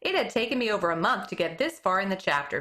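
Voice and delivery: ordinary delivery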